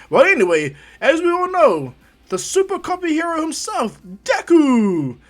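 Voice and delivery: impressed announcer rising to a loud, high shout; hyped arena introduction, climbing in pitch; tournament announcer. Acted. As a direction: build up to the fighter's name. Announcer introduces the second fighter.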